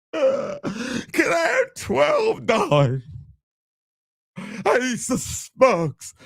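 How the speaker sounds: high, strained mock sobbing; whiny crying son. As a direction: bawl to mommy like an overgrown child. Acts out whining son to mom.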